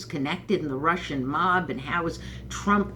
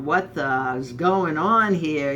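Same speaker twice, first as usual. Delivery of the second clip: put-on slow, louder Brooklyn Italian accent; 5 dB louder, slower than usual. Neighbors joking about the news, slow Italian accent. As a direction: drag it out in an Italian-American accent. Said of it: "you gotta put on the Italian accent".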